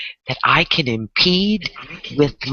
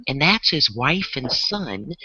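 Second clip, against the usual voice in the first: ordinary talk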